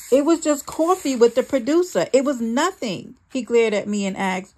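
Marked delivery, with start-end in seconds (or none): 0.1-3.1 high, loud defensive yell; yelling back. A narrator's reading. Wife yells back at her jealous husband, high and loud. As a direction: shout the excuse back at him. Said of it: "I yelled back".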